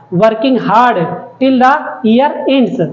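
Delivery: slow, word-by-word classroom reading; slow reading aloud. Shown as a demonstration. Teacher reading the line to the class, very slowly. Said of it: "teacher reads poem line slowly".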